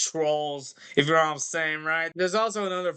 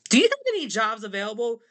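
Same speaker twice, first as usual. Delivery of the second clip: quick, higher-pitched penguin; 7 semitones higher, faster than usual. Acted. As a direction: pipe up eagerly as a cartoon penguin. Penguins voiced asking for work.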